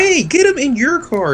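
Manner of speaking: very high insistent mock yell; mock screaming. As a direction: mimic an insistent crowd, pitch shooting up. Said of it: "everybody screaming at them".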